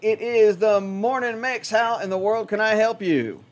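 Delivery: slow, drawn-out radio DJ greeting; on-air announcer. Acted. DJ greeting a caller live on the morning show, announcer voice. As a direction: roll out the morning show welcome like a DJ.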